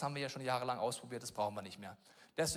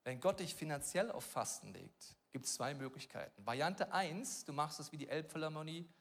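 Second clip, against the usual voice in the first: usual speaking voice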